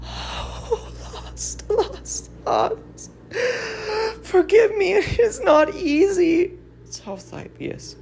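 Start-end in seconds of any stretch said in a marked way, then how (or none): 0.0-6.6 breathless sobbing, much higher, words breaking; grieving mother apologising through sobs, much higher; sobbing grief. Acted. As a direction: she can barely get the words out through tears. Mother grieving for her son.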